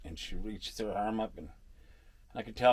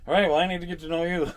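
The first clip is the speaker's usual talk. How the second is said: loud, high, laughing reply; laughing greeting; 9 semitones higher, 12 dB louder than usual. Pretend. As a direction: greet future fans while laughing. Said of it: "I'll be like".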